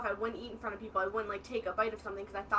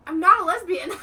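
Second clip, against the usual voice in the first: loud, higher indignant protest; retold furious denial, much louder; mad denial; 8 semitones higher, 14 dB louder than usual. Daughter to mom over the haircut, angry denial. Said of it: "I was so mad for so long".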